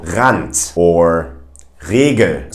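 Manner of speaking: Rand and Regel with R from the throat; Regel, uvular R, slow; German R words. Shown as a demonstration. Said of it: "comes from the back of the throat".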